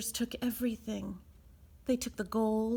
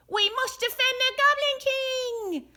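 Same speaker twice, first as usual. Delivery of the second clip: fast, loud, much higher goblin cry; rallying goblins; 5 dB louder, faster than usual. A narrator's reading. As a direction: charge to defend the king.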